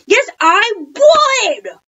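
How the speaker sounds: high, loud, drawn-out insistence; shouting. A narrator's reading. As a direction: shout back in eager protest. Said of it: "I shouted".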